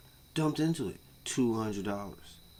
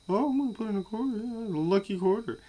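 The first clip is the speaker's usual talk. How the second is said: high, fast silly voice; mocking the superstitious player; mocking gambler; 11 semitones higher, faster than usual. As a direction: mimic a naive gambler, higher and quicker. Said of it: "you see them talking about some".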